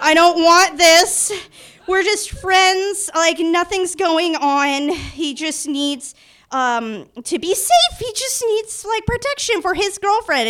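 slow, high-pitched acted squirming; flustered excuses. Excusing herself to friend's mom, high and flustered. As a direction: stall in a high, awkward mock voice.

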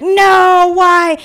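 high, loud mock wail; acted dismay. Seeing her friend's mom walk in, loud dismayed wail. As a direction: draw it out in loud mock horror.

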